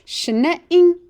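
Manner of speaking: a clear call for them to echo; model word again. Shown as a demonstration. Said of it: "one more time".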